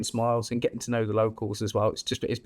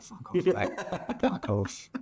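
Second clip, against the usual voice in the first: laughing brush-off; 4 semitones higher, much slower than usual. Retold or imitated. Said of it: "And I'm like".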